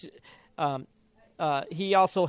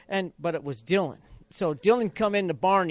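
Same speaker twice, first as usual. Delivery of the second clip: ordinary talk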